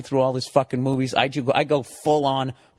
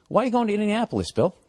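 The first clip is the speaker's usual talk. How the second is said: quick, pitched-up grilling question; mock interrogation; 7 semitones higher, faster than usual. Drunk passenger grilling seatmate, quick mock interrogation. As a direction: fire the question off like a cop. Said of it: "he's fucking interrogating me".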